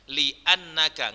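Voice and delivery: "Li annaka" with a glottal break after Li; careful "Li annaka", hamza sounded; hamza pronounced. Shown as a demonstration. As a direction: keep Li apart from annaka. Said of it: "nggak boleh dibaca".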